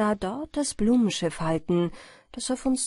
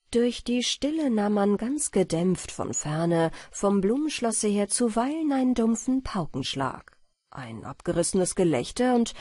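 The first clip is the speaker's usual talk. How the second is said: plain reading voice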